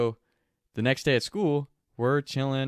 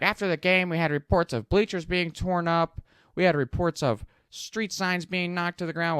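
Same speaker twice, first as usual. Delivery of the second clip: higher, put-on principal voice reading off offences; principal listing complaints; 8 semitones higher than usual. Principal scolding the gym in a higher put-on voice. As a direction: list the damage like a stern principal.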